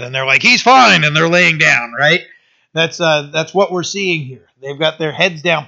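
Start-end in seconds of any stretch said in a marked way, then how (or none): 0.4-1.0 eager answer. Retold or imitated. Brothers answer eagerly while bowing.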